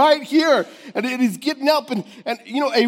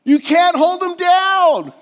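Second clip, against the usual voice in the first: high, loud shout of pure joy; joyful shout; 12 semitones higher, 8 dB louder, slower than usual. Acted. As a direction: leap and yell it out, laughing. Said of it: "He's like".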